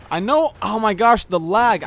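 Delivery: loud, high exasperated cry; frustrated outburst. Real, not acted. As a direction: shout at the lag. Frustrated at lag death.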